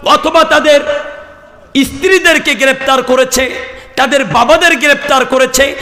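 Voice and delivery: high-pitched, insistent preaching list; impassioned denunciation. Real, not acted. Preacher listing arrested children, wives and parents, high insistent denunciation.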